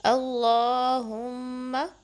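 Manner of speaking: अल्लाहुम्मा drawn out very slowly, the हमज़ा joined to the लाम; slow, careful recitation; slow tajweed demo. Shown as a demonstration. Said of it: "हमज़ा को लाम से मिलाना है".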